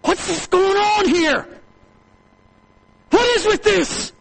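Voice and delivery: put-on indignant older brother, high and loud. Older brother hears the party.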